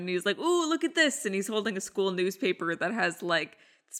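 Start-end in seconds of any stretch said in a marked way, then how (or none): 0.4-1.3 higher mock excited ooh; Ray's mock glee. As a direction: show off a find eagerly. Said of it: "Ray excitedly comes down".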